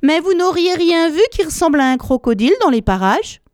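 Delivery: put-on high, courteous child's question; polite question. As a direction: ask sweetly and politely. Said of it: "avec une grande politesse".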